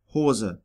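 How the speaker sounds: Hose with a pure long O; long German O. Shown as a demonstration. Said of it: "The long O has no equivalent in English".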